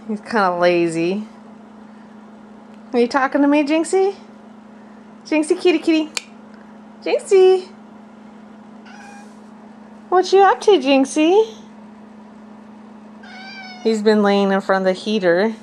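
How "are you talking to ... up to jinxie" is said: high, sing-song calling of a cat; high pet talk. Real, not acted.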